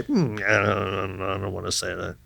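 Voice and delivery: mock reluctant grumble. Actor balking at the writer's words, low reluctant grumble. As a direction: hem and refuse under your voice. Mimicking a fussy actor's refusal.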